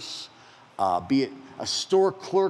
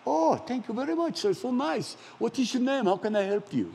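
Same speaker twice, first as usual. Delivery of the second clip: grateful agent; 8 semitones higher than usual. Retold or imitated. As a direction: lift the voice into grateful surprise. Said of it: "when you hear in their voice".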